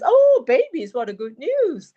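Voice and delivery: Welsh mum. Retold or imitated. Daughter retelling mum's response to pregnancy news, in a high Welsh accent. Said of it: "I do quite a good Welsh accent".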